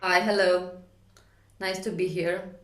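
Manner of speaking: ordinary delivery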